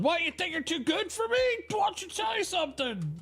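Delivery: very high, squeaky shouting dog voice; mock yelling dog. Neighbor's dog yelling at the calm dog, very high. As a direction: shriek like a yappy dog.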